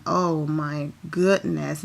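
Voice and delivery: ordinary talk, nothing marked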